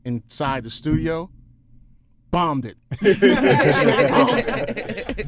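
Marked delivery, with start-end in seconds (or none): 2.3-5.3 repeats it laughing, higher and louder. Real, not acted. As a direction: laugh at your own flop.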